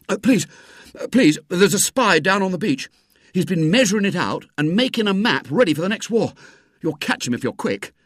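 put-on excited boy reporting a spy; urgent boyish report. A narrator's reading. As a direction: urge a policeman to act at once. Boy runs to alert a policeman.

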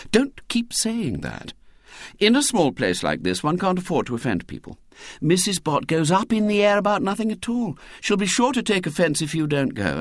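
lightly voiced mother, hurried and fretful; mother's voice. A narrator's reading. Mother fusses at William about the party, lightly voiced.

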